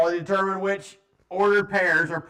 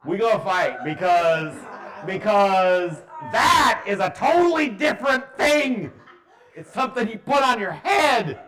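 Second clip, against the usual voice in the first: loud, high, hammed-up indignation; playfully rant, building to a shout; mock outrage; 5 semitones higher, 6 dB louder than usual. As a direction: pretend you'd fight over this. Teacher mock-rants about a mispronunciation.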